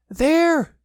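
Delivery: high, loud frightened squeal; high-pitched little animal yelp; Piglet. A narrator's reading. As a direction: cry it out in alarm. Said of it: "said Piglet".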